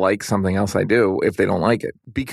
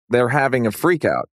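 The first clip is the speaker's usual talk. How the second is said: ordinary talk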